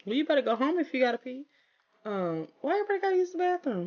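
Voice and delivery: high, fast, fed-up scolding; exasperated scolding. Real, not acted. Player scolding her sims for needing the bathroom, exasperated. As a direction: rattle it off, fed up with them.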